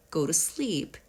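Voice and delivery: ordinary talk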